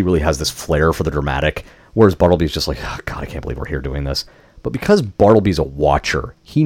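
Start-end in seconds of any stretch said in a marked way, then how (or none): 2.8-4.2 low, soft weary grumble; weary reluctance. Retold or imitated. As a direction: mutter it low, fed up with the job. Bored partner sighs at the task.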